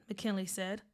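usual speaking voice

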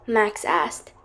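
ordinary delivery

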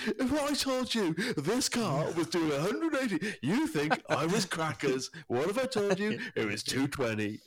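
high, slow, theatrical car-show presenter; milk the build-up like Clarkson; hammed-up Clarkson speed boast; Clarkson impression. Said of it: "doing the old Clarkson".

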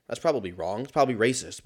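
normal talking voice

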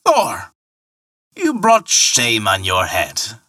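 slow, contemptuous rebuke; the ferryman scolding the god with contempt; scathing. A narrator's reading. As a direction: pour scorn on Thor. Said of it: "said Harbard scathingly".

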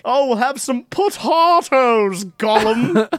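lightly voiced cheerful hobbit; Samwise impression. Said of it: "imagine Samwise Gamgee just like".

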